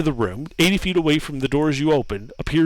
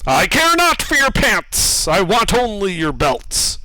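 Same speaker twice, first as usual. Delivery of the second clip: booming statue voice; 5 semitones higher than usual. Acted. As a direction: lord it over them, booming.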